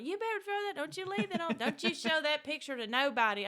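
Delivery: high, loud stern mother; imitating mama laying down the law; mama warning. Mother warning her daughter not to show the picture, high and stern.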